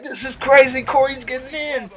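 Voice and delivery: high, hyped-up; imitating pumped freshmen; mock amazed cheer; excited teammates. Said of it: "We're like".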